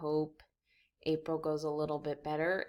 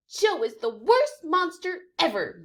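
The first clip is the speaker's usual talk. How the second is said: higher, milder yip; yipping complaint; 9 semitones higher, 7 dB louder than usual. Acted. Fifi complaining about Joe, milder.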